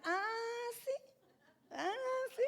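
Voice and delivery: high, loud, hammed-up indignation; mock spiteful comeback. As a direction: exaggerate an offended "oh, really?". Hams up the same spiteful retort.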